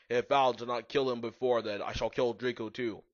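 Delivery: usual speaking voice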